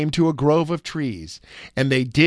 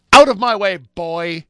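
loud, higher, scornful command; angry shout; 5 semitones higher, 7 dB louder than usual. A narrator's reading. Greedy Manyara shouts the hungry boy aside. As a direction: bark the boy out of the way.

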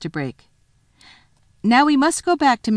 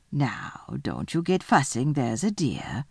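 lower, softer motherly voice; soothing; 4 semitones lower, 3 dB quieter than usual. Acted. Missus Beaver calms Susan, low soothing voice. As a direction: settle them down gently and low.

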